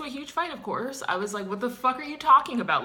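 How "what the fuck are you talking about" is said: loud, fast indignant yell; higher, louder outraged retort; angry shout. Retold or imitated. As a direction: fire it back at her. Acted quarrel with girlfriend.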